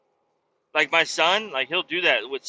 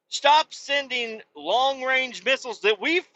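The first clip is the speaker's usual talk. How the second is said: heated rant; 8 semitones higher than usual. Real, not acted. Ranting at Ukraine, mocking.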